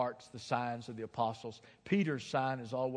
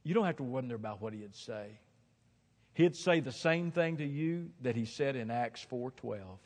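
ordinary talk

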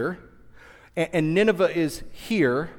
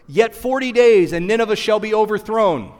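louder, higher proclamation; proclaiming loudly; 4 semitones higher, 7 dB louder than usual. Acted. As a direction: announce it like a prophet. Quoting Jonah's proclamation.

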